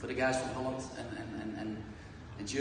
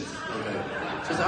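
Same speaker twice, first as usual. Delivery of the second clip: usual speaking voice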